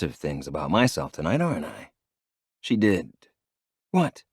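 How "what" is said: higher, sharp retort; sharp snap. A narrator's reading. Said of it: "she snaps".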